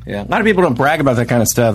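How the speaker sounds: normal talking voice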